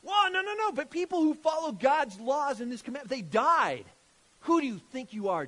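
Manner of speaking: high, loud mock protest; hammed-up indignant outburst; acted objection. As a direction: break in like an affronted religious leader. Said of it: "These Pharisaical leaders here".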